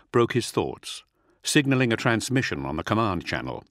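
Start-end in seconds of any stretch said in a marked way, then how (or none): none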